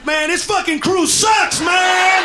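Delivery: high, slow, loud mock whine; mock complaining slave. Slave on the ship two weeks later, loud high mock whine. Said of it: "two weeks later".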